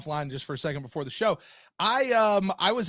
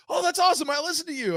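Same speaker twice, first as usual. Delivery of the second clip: excited fan; 10 semitones higher, faster than usual. Retold or imitated.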